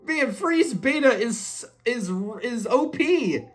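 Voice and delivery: excited amazement. Real, not acted. Gamer raving over an overpowered freeze attack, excited amazement. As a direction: marvel in a high, stretched voice.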